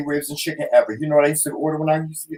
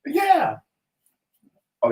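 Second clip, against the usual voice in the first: usual speaking voice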